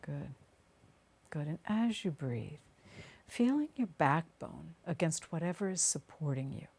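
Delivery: unhurried meditation voice with long pauses; guided meditation. Acted. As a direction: guide them gently down into the body, phrase by phrase.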